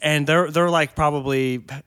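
normal talking voice

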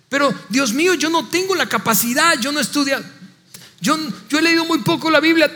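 high-pitched mock protest; pleading excuses. A believer telling God they lack training, pleading. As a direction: plead inadequacy, voice pitched well up.

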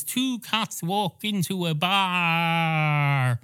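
slow, drawn-out joke-opening delivery; old-fashioned bar-story opener. Acted. Barman mimicking a traditional bar story, slow storytelling drawl. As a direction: stretch the words out, especially the last. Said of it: "the type where he goes".

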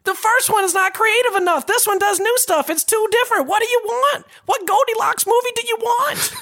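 high-pitched exasperated ranting; fed up, demanding an answer. Real, not acted. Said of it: "He turned into Dan Harmon".

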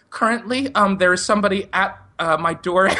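high, halting report to an operator; mock-panicked caller choosing words carefully; nervous high voice. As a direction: pitch it up, hesitating between phrases. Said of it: "ten octaves higher than it normally is".

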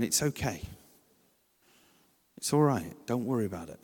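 soft, gentle reassurance to a stressed parent; calm them down kindly; softly reassuring a parent whose child melts down. Shown as a demonstration. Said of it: "If you're there going".

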